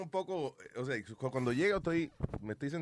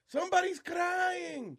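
high, slow, drawn-out DJ call; DJ announcer voice; 14 semitones higher, much slower than usual. Retold or imitated. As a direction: stretch it like a club DJ on the mic. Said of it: "el disc jockey empieza".

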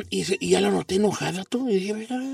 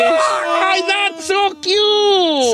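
squealing delight; 8 semitones higher, much slower than usual. Real, not acted. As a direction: gush over how cute it is. Reacción a apodos tiernos. (chillido agudo de ternura)

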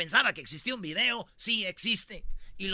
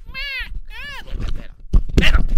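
put-on much higher squeaky baby pleading; mock baby voice; 12 dB louder than usual. Imitating the baptized baby, high squeaky plea. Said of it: "y el niño".

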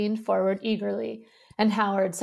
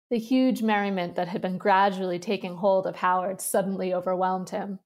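ordinary delivery, nothing marked

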